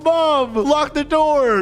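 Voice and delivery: high, slow mock call to mom. Bully calling to his mom from the yard, high slow mock yell. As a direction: yell it toward the house, hammed-up.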